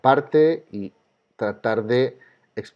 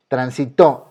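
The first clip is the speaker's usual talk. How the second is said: Transitó with TÓ hit hard and louder; stress on last syllable; 5 dB louder, slower than usual. Shown as a demonstration. Teacher tests transitó against the rule, last syllable stressed. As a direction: punch the final syllable of Transitó. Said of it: "es una palabra aguda".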